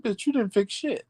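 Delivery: high scornful dismissal; indignant retort. Real, not acted. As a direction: snap back in disbelief.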